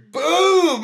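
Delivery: shouted high boom; loud boom. Retold or imitated. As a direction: blast it like a punch. Mimicking Jake's punch.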